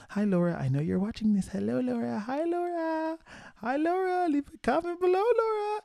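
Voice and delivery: sing-out hello climbing into a very high squeal; mock-cutesy high-pitched shout-out; playful greeting. Playful greeting to absent friend.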